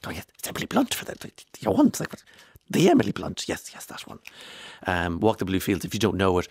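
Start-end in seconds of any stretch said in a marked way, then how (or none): 0.1-3.5 mock-flustered, higher-pitched name-drop; hushed fluster rising to an impressed exclamation; retold gasps. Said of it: "there were gasps in the whole room". 3.5-4.2 soft, near-whispered confirmation; quiet retold aside; hushed reply. Retold casual reply to stunned room.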